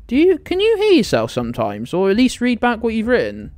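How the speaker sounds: can't believe what she's reading; incredulous. Real, not acted. Streamer scolding chat for its logic, incredulous.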